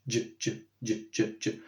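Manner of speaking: soft, short dzh sounds repeated; voiced j. Shown as a demonstration. Drilling the sound from gin, voiced j. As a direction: murmur each j with the voice on.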